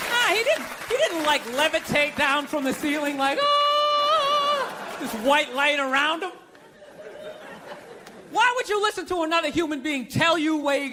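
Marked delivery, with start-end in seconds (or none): 3.4-5.0 mock angelic choir. Preacher descending with heavenly light.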